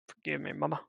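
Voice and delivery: understated cartoon-style plea to mama; Johnny Bravo-like. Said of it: "the way I said it reminded me of Johnny Bravo".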